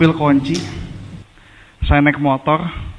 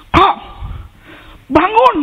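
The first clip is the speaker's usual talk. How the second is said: security guard; 4 dB louder, slower than usual. Retold or imitated. Security guard addressing him.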